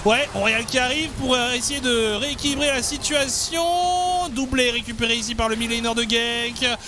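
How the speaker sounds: esports caster. Acted. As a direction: call the fight live, high and energetic. Live play-by-play.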